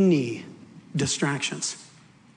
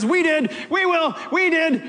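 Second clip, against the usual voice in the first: proclaiming; 8 semitones higher than usual. Acted. Apostle's testimony proclaimed.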